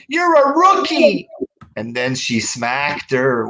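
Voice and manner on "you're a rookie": high, loud mock yell; hammed-up wrestler's shout; forced outburst. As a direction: imitate a stagey in-ring taunt, pitched way up. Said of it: "turns on her in the forced way".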